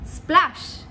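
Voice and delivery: splash said as a sound effect. Shown as a demonstration. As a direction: act out a splash. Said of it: "pensar en un ejemplo". (splash como efecto de sonido)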